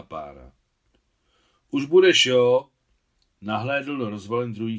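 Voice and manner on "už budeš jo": put-on high, loud boyish call; a boy peeking into the ruins, calling loudly. A narrator's reading. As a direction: hurry your friend along like a kid. Boy calls to friend.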